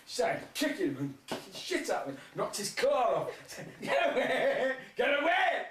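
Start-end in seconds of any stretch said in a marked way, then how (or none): 3.8-5.7 high, loud mock yell building; hammed-up desperate get away; panicked shout. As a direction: cry out as if being kicked. Crab fleeing attacking prawn.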